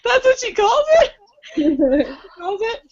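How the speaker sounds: high-pitched mock disbelief; incredulous. Reader reacts to what the heroine calls it, incredulous. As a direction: squeak the question in amazement.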